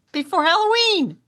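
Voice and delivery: high-pitched disbelief; incredulous. Real, not acted. Telling co-host about poinsettias on sale in October, incredulous. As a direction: pitch up in amazement at the absurdity.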